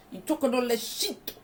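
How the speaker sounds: a light mock Irish accent on the line; imitating heavy Irish accent. Recapping the Irish father's threat, light mock Irish accent. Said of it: "a heavy accent".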